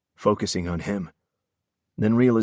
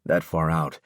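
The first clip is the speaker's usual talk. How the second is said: ordinary delivery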